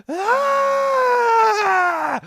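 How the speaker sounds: long, high, loud scream; cartoon scream. Go finding the Grookey on his wrist, a long high scream. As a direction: shriek like a startled cartoon boy.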